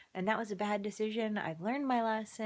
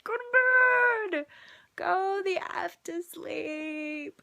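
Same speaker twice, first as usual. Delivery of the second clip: slow, much higher and louder, near tears; an exhausted mom at the end of her rope; desperate parent command; 9 semitones higher, 5 dB louder, much slower than usual. Retold or imitated.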